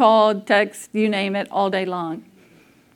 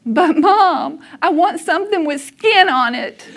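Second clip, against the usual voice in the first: put-on higher, louder childlike plea, almost laughing; little boy pleading; 6 semitones higher, 5 dB louder than usual. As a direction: play the kid whining to mom.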